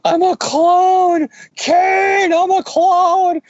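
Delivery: high, slow, crying wail; melodramatic wail. Retold or imitated. Mocking Ben Reilly's clone angst, high crying wail. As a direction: ham up a clone's anguished sob. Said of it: "Ben's doing more of the whole".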